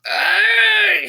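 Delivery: high, loud raging roar; exploding yell. A narrator's reading. Michael exploding at the reporter, loud angry roar. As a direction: let rip an angry roar. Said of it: "that's when Michael exploded".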